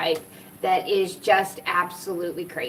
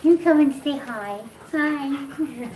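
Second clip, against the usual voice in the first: higher, playful cooing greeting; high coaxing; 4 semitones higher, slower than usual. Real, not acted. Inviting Papa to say hi, high coaxing voice. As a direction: coax sweetly in a raised little voice.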